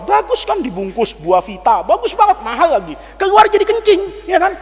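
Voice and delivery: ordinary talk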